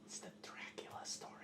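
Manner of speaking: very soft whispered storytelling; spooky whisper. Acted. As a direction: whisper it like an ominous tale. Player's spooky whispered aside.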